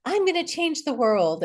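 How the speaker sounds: lightly acted declaration of a young idealist; young self. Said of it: "around eighteen, nineteen, twenty, I was like".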